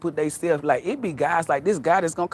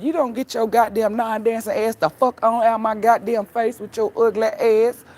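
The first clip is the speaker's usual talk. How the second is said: higher-pitched indignant rant; mock telling-off; 8 semitones higher, 4 dB louder than usual. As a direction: chew out a clumsy dancer, hammed-up. Acting out a telling-off on dance floor.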